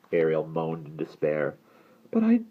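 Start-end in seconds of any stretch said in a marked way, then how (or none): 2.1-2.4 put-on high, plaintive girl's voice; high, unsteady protest; faltering. A narrator's reading. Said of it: "she faltered".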